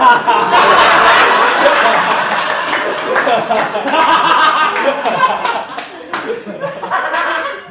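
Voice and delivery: big high laugh at his own joke; long high cackle; loud laugh. Real, not acted. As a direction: burst out laughing.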